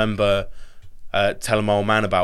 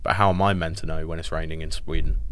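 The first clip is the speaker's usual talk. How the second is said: ordinary talk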